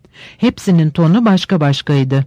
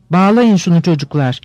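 ordinary delivery

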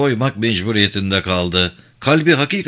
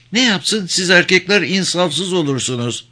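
higher-pitched woman's voice; reproachful woman, voice raised high; reproachful wife; 6 semitones higher than usual. Acted. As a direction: chide the man in a lifted pitch. Wife reproaches the man for cruelty.

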